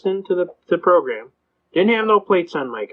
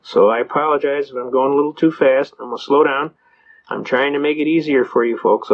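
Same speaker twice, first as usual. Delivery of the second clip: usual speaking voice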